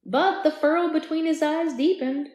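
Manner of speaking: put-on narration slipped into the character's accent; accidentally voicing the narration as Jack; Jack's accent by mistake. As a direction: read it in his accent by accident. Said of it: "I said that in his accent".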